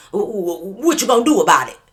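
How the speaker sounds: loud, stuttering start, then fast taunt; taunting outburst. Retold or imitated. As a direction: dare her to do something about it. Taunting Becky in retelling.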